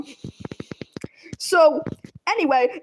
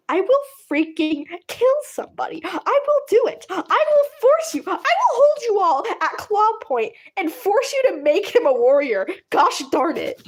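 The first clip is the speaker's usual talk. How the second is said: rising hammed-up rage that climbs much higher; playfully raging, escalating each line; mock furious threat; 7 semitones higher than usual. Mentor threatens the clan.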